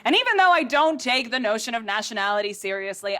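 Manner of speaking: ordinary talk, nothing marked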